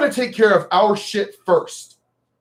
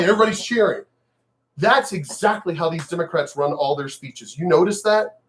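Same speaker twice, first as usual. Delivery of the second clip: ordinary delivery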